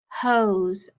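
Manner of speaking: ordinary talk, nothing marked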